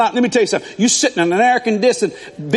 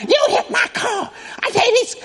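high, shrill-pitched furious yell; angry woman yelling. Retold or imitated. Woman whose car was hit yells at the young driver, high furious shout. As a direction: screech at the boy who hit your car.

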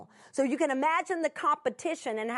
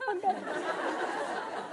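tearful; 9 semitones higher, much slower than usual. Real, not acted. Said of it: "are y'all about to cry".

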